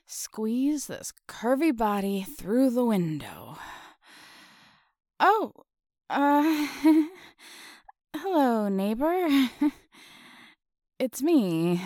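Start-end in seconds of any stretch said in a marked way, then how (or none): none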